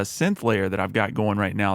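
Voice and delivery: usual speaking voice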